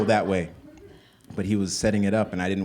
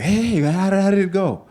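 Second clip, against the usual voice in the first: much higher and louder, a mother pouncing with questions; eager Ugandan mom; 13 semitones higher, 9 dB louder, slower than usual. Retold or imitated. Mother grilling her son about the night, high and loud. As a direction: play the mom who can't wait to hear. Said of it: "the Ugandan mom".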